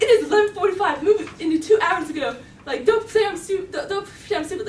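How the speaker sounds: high, loud, rapid-fire scolding; mad dad. Retold or imitated. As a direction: imitate an exasperated parent telling off a teen. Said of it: "He's just mad".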